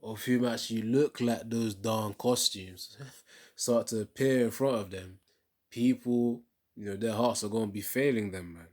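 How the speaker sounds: loud preaching warning with bursts of laughter; half-laughing, rising into a loud warning; fired-up warning. Real, not acted. Commentator warning viewers about demons, loud and laughing.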